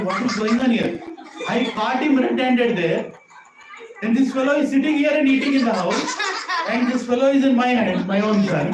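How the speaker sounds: high-pitched bewildered protest; flabbergasted. Acted. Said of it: "This gopi is flabbergasted".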